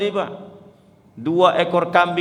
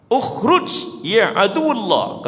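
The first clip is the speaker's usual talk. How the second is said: high, slow stern command; Prophet commanding the jinn; 8 semitones higher, slower than usual. Acted. Prophet ordering the jinn to leave, commanding high and slow. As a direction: order the spirit out, drawn out. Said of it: "kata Rasulullah".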